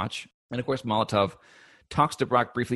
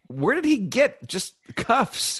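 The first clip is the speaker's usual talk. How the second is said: exaggerated disbelief; 4 dB louder than usual. Real, not acted. Incredulous about Hank's outfit.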